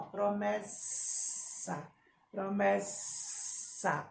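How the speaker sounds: "promessa" said slowly, the ss hissed long; slow "promessa" with a snake-like sss; hissing S. Shown as a demonstration. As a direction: stretch the ss in "promessa" into a hiss. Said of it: "É o sonzinho de quê? Da cobra".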